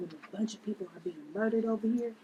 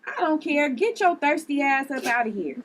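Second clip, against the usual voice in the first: loud, higher, telling him off; angry shout; 7 semitones higher, 8 dB louder than usual. Acted. Liv throwing the guy out of the house, loud angry shout. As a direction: bark him out the door.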